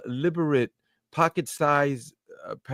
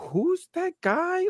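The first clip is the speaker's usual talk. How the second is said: puzzled; 4 dB quieter, slower than usual. Retold or imitated. Acted puzzlement at statues.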